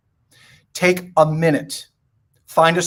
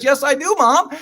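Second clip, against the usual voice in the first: put-on boy's voice; 8 semitones higher than usual. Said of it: "He says".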